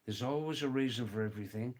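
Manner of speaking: fast, run-together saying; brisk casual delivery, rising at the end; quick repeat. Shown as a demonstration. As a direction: rattle it off in one go.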